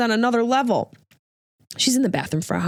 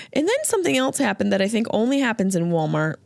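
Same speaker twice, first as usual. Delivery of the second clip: ordinary talk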